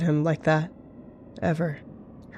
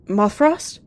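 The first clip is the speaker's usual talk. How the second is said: raised, louder questioning cry; alarmed cry; 5 semitones higher, 5 dB louder, slower than usual. A narrator's reading. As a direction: cry out for a fallen companion. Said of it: "she cried".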